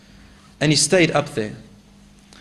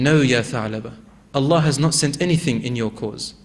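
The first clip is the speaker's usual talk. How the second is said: reassuring; 6 semitones lower than usual. Acted. Prophet reassures crying man.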